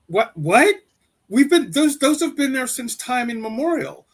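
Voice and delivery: high-pitched disbelieving protest; incredulous. Retold or imitated. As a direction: react in baffled disbelief, voice jumping up. Incredulous reaction to accusation.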